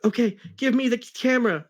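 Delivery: hammed-up excited demand; high, pushy grab for the camera; mock scream. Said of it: "screamed Tails to Sonic".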